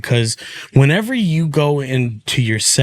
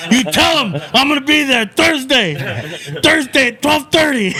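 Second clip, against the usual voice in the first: high-pitched, laughing rant in imitation; retold rant squeezed high through laughter; worked-up comedian; 10 semitones higher than usual. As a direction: imitate a comic cracking himself up as he rants. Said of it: "he would get himself worked up".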